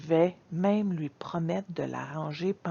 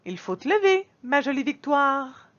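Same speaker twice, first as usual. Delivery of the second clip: tender wake-up; 6 semitones higher, 4 dB louder than usual. Acted. Mother wakes her daughter.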